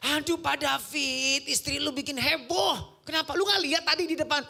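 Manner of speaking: mock usher lady gushing to pastor; excited usher lady. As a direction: play the usher bursting with news. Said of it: "ibu-ibu usher".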